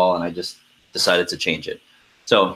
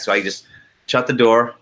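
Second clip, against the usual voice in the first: ordinary delivery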